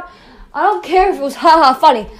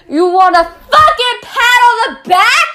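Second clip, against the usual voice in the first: ordinary talk, nothing marked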